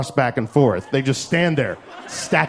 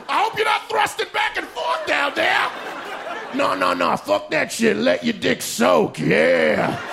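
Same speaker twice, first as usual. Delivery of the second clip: mock God; 9 semitones higher than usual. God cheering on soaking teens, high hammed-up yell.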